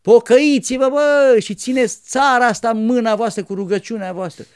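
put-on loud, high preaching at the kids; shouting sermon. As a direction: thunder a call to repent. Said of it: "striga la noi".

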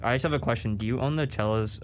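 usual speaking voice

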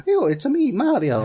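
high, bright-eyed cartoon plumber greeting; Mario voice. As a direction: pop up high and cheerful as the plumber. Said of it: "just walked in and went".

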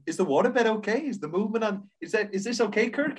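mild imitation of the director; lightly voiced Jack. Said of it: "quoting scene".

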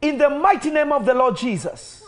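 from intense to high, loud commanding release; building to full preaching. Acted. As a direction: drive it up like an altar call climax. Building deliverance proclamation.

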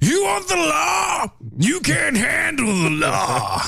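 high, slow hammed-up shout; mock movie shout. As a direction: bellow it like a courtroom film villain. Movie-quote parody, shouted.

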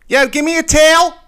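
high, loud mock Northeast "Yo, give me a tail"; Northeast accent impression. Philly guy asking for a towel, Northeast accent. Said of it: "a thick Northeast accent".

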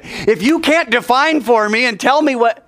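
high-pitched indignant demand; acted exasperated outburst; retold angry protest. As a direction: play it very worked up, pitched high. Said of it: "he's very angry".

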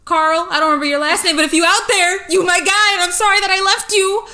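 loud, high-pitched public apology; mock heartfelt shout-out. Calling out to an old school friend who might be listening, loud mock-earnest shout-out. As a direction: call out to a long-lost friend.

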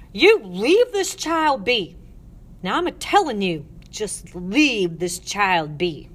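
loud, slow, emphatic command; forceful warning. Acted. Ruth halting the matrons, forceful warning.

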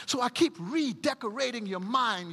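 ordinary delivery

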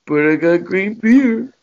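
slow, dopey mock voice; mock dopey friend. Retelling his buddy's excuse, slow dopey mock voice. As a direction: drawl it out like a proud dimwit. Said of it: "And he goes".

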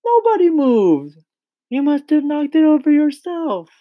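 loud squeaky high-pitched protest; children crying out. A narrator's reading. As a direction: shout up high as a protesting class. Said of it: "they cried out".

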